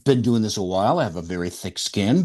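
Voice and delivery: ordinary delivery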